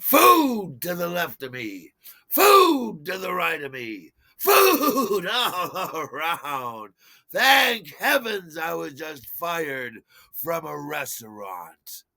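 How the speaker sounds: slow, loud declamation, high on each opening word; hammed-up heroic recital, drawing out the vowels; mock-grand oration. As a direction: proclaim it with theatrical triumph. Imagined grand speech, arms outstretched.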